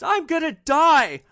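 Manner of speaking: high, drawn-out anguished yell; explosive outburst. Retold or imitated. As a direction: explode as the distraught passenger, loud and high. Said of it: "And she just explodes".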